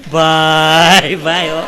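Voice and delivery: loud first word held very long; drawn-out exclamation. Real, not acted. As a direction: stretch it out, then drop off. Comedian's drawn-out exclamation after a joke. (મોટેથી લાંબો ખેંચેલો ઉદ્ગાર)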